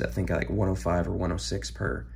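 ordinary talk

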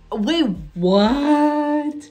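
loud, high squeal of disbelief; shocked. Real, not acted. Anime fan discovering a surprise voice role, loud shocked cry.

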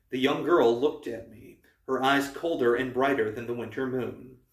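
usual speaking voice

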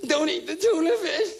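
high, slow, whining activist voice; mock a weepy animal lover; whiny activist. Mocking an animal rights activist.

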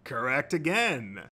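slow, drawn-out host's verdict; quizmaster. A narrator's reading. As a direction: proclaim the verdict with showmanship. Quizmaster confirms answer to audience.

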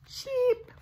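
high tiny cheep; one little sleepy peep; chick cheep. Acted. Parent voicing the tucked-in chicks, high tiny cheep. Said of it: "the chicks whisper".

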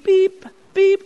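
high mock beeping of a heart monitor; monitor beeps. Nurse imitating the heart monitor for the child, high beeps. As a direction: chirp it like the machine.

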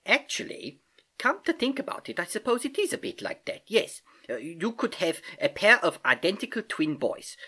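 put-on accented uncle thinking aloud, pitch lifting as he concedes; Uncle Albert, musing. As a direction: mull it over as the kindly old uncle.

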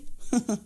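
short, soft scornful laugh; sarcastic laugh. A narrator's reading. Amir mocking his wife with a soft scornful laugh. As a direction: scoff at her under your breath. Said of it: "بصلها أمير بسخرية".

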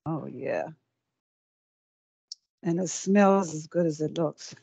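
ordinary talk

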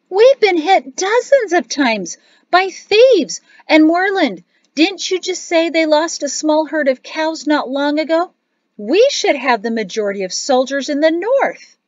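high, loud outburst of objection; child's loud, worked-up protest; indignant protest. A narrator's reading. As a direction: argue it loudly, pitched well up. Child protests after loud exclamation.

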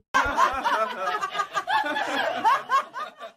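a rising, very high burst of laughter. Real, not acted. Watching Yamcha die in a parody, high burst of laughter. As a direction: break into a high cackle. Cracking up again.

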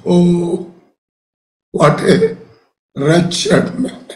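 low, sorrowful cry of despair; mock cry. Preacher voicing Paul's cry of despair, sorrowful. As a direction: groan it out like a broken man. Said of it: "Finally, he cries out".

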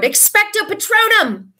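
loud, high-pitched incantation shout; roar. A narrator's reading. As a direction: roar out the spell.

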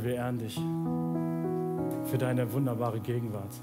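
usual speaking voice

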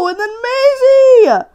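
anguished cry. Real, not acted. Maisie shows up in game.

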